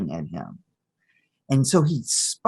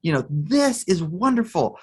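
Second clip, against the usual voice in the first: put-on higher, wonder-struck little-boy delight; hammed-up, awed excitement; delighted child; 7 semitones higher than usual. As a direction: act out a kid lighting up at a treasure. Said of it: "he lights up and he says".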